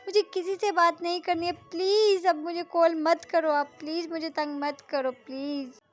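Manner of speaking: put-on girl begging her friend to stop calling, tearful; upset crying girl. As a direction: beg to be left alone, near tears. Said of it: "कविता है वो बोलती है".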